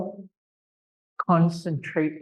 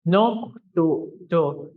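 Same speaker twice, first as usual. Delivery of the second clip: slow model word; slower than usual. Shown as a demonstration. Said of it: "the next word is noktuto".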